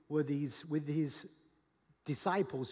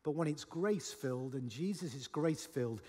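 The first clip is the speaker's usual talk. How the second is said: ordinary talk, nothing marked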